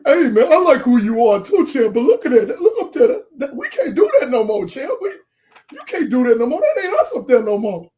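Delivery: put-on half-laughing plea to an old champ; Apollo pleading. Host retelling Apollo telling Rocky to look at the screen, loud and laughing. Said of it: "he's like".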